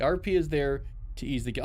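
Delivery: ordinary delivery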